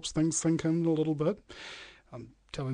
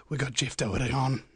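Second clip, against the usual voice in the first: normal talking voice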